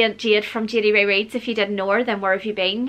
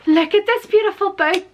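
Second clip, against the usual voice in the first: excited; 9 semitones higher, 4 dB louder than usual. Real, not acted. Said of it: "I was very excited for this one".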